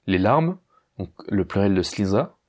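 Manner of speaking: normal talking voice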